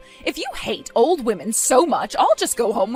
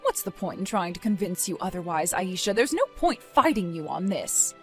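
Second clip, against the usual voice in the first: low, soft and defeated; weary resignation; 5 semitones lower, 6 dB quieter than usual. Acted. Mother stops arguing with her daughter Aisha, weary resignation. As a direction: give up arguing, voice dropped low.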